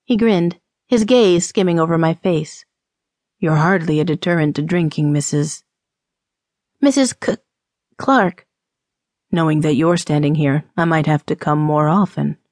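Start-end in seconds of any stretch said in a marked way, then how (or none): 3.4-5.6 low, quick teasing man's voice; teasing saloon drinker. Acted. Saloon drinker to temperance woman, low and teasing. 6.8-8.5 flustered stammer. Acted. Flustered woman stammers her name. 9.3-12.4 lower-voiced man, teasing her; flirtatious saloon drinker. Drinker flirting with temperance woman, low and teasing.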